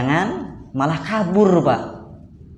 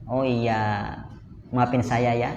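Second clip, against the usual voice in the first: soft, low conciliatory husband; good listener; 6 semitones lower, 6 dB quieter, slower than usual. Acted. Husband apologising to complaining wife, softly conciliatory. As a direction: lower and soften it into a gentle apology. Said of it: "jadilah pendengar yang baik".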